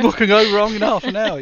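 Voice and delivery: high, loud mock-innocent question; sarcastic. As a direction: play it wide-eyed and ironic. Echoes ironically, mock voice.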